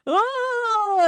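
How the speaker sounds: high, wavering oh on the edge of tears; a hammed-up sob starting to break; mock tearful oh. Telling a friend about crumbling at her friend's question, mock tearful. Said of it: "I'll just be like".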